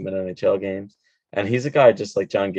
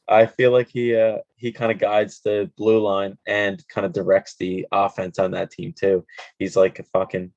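normal talking voice

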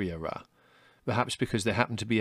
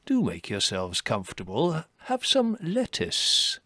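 courteous chief, swooping high and slow at the end; gracious old host; 7 semitones higher, slower than usual. A narrator's reading. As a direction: play the grand old host, drawing it out. Chief rabbit hosting visitors.

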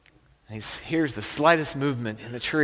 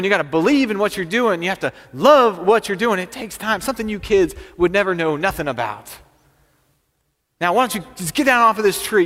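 put-on higher, insistent lecture to a kid; worked-up old-timer's telling-off; scolding farmer; 6 semitones higher, 4 dB louder than usual. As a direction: lay down the hard truth, then shoo them off. Farmer lecturing then shooing the boy.

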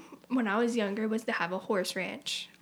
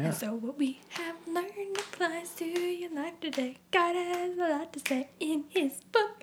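VeggieTales narrator; 6 semitones higher than usual. Pretend. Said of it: "All right, VeggieTales".

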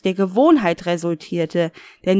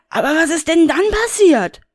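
high, indignant Rainer voice; a high, put-out grumble; Rainer, high and agitated; 10 semitones higher, 6 dB louder than usual. Acted. Rainer brushes off the caller, high and huffy.

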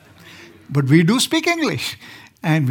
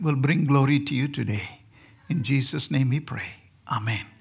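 prayer; 4 semitones lower than usual. Acted. Opening prayer before the sermon.

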